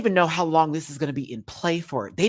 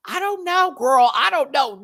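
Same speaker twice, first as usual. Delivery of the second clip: much higher, mocking whiny voice; mocking clueless contestants; 13 semitones higher, 5 dB louder than usual. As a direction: play dumb in a high, sing-song mock. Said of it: "How y'all gonna go".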